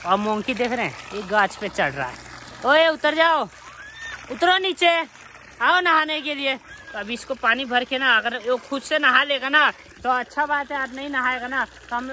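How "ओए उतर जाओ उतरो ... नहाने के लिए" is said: calling out. Real, not acted. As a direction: shout up at the monkey to come down. Calling monkey down from tree. (पेड़ पर बंदर को ऊँची आवाज़ में पुकारना)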